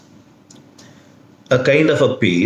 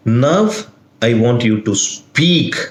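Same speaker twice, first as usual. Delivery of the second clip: "Now", pause, then "speak" lifted; stress on SPEAK. Shown as a demonstration. Police officer interrogating a silent convict, stress on "speak". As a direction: build to a punched "speak". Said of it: "the emphasis will be the word speak".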